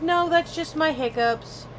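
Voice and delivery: high, exasperated insisting; weary explanation. Retold or imitated. Telling coworkers it's just hiccups, exasperated and high. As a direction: plead it's only hiccups. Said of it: "I'm like".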